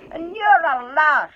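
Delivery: high, loud, slow furious troll; high, loud troll losing his temper; angry troll. Acted. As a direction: trolls squaring up for a brawl. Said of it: "said Bert".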